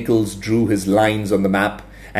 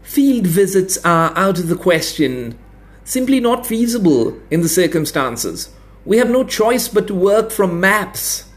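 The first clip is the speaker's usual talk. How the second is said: higher, stiff and prim; defensive academic; 8 semitones higher than usual. A narrator's reading. Expert defending map work to officials, prim and stiff. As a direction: insist on it primly.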